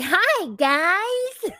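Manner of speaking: high, loud sing-song hi; mock Gino greeting. Podcaster mimics Gino greeting the women, high and loud. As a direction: ham up Gino's entrance. Gino enters, voiced in recap.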